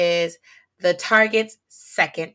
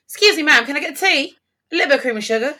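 mock British accent; 5 semitones higher than usual. Passenger putting on a British accent with the flight attendant, mock British. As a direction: put on a British accent asking for tea.

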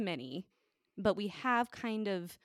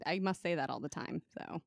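ordinary talk, nothing marked

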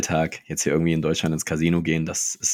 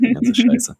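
squeaky high mock voice; 3 dB louder, slower than usual. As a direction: jump way up high, mocking. Tops off the teen mockery.

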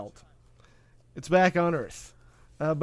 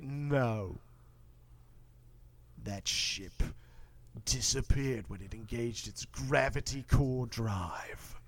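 much lower, softer, slow and grave; ominous scientist; 7 semitones lower, 10 dB quieter, slower than usual. Retold or imitated. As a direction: correct him in a dark hush. Doctor Weir voiced in retelling.

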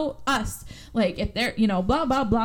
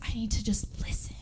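hushed whisper to herself; whispered inner thought; 8 dB quieter than usual. Retold or imitated. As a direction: whisper it under your breath. Retelling a character's whispered thought.

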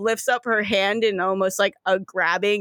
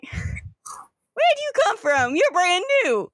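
Southern Floridian gator; 6 semitones higher than usual. Acted. Gators sizing up a newcomer, full Southern Floridian accent. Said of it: "the gators are the full Southern Floridian".

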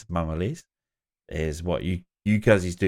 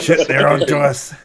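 a hammed-up rush of fake alarm, louder; mock panic; 5 semitones higher, 9 dB louder than usual. Mother in the tailing car voiced in mock panic. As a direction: play the caught-out getaway driver.

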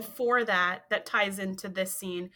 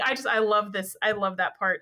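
normal talking voice

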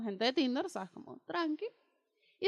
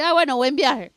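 louder, cutting goodbye; curt; 9 dB louder than usual. Retold or imitated. Cutting off the guy who left again, a loud curt farewell. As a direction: shut the conversation down sharply. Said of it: "Se la corté ahí".

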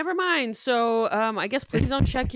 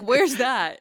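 mock confusion; 8 dB louder than usual. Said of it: "I'd be like".

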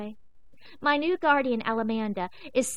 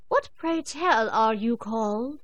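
haughty royal cat; 4 dB louder, slower than usual. Acted. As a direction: look down your nose and ask slowly. Ginger's mock-royal question.

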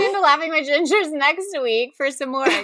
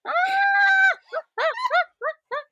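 helpless high-pitched cackle; high shrieking laugh. Real, not acted. Big laugh after correction.

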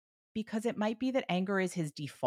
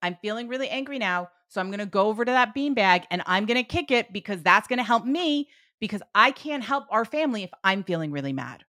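mildly heated, raised voice; controlled mock frustration, higher and louder; modelled anger; 4 semitones higher, 6 dB louder than usual. Shown as a demonstration. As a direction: act out naming your anger to a child. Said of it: "modeling what we want him to do when he is angry".

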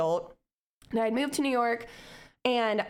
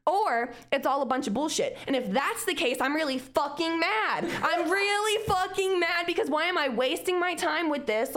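building from plain to a higher, mock-furious rant; voicing her younger self getting madder and madder; angry kid rant; 7 semitones higher than usual. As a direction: let childhood anger climb line by line. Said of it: "I was so angry".

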